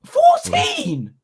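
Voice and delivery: high, loud incredulous "Fourteen?"; shocked disbelief. Real, not acted. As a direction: squeal it in disbelief. Shocked at fourteen billion views.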